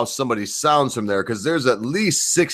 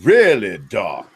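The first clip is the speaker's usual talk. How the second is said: dramatic deep question; 5 semitones lower, much slower than usual. Shown as a demonstration. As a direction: say "Dark?" deep and theatrical, like a villain. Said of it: "I would like to practice my British accent".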